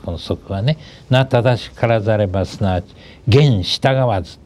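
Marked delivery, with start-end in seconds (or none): 3.3-4.4 slow, raised classical reading; slow recitation. Shown as a demonstration. Teacher reciting the classic to his listeners, slow. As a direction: intone the maxim with weight. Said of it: "言従わずって".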